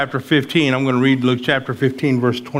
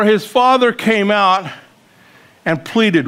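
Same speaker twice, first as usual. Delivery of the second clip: higher, pressing questions at full preaching force; impassioned preaching; 6 semitones higher than usual. Acted. Preacher to congregation about the angry brother, impassioned. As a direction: build the fire, demand an answer.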